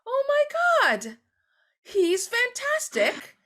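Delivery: sarcastic fan swooning over Tom Hanks; mock gushing praise. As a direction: overdo the adoration while laughing. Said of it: "we're like".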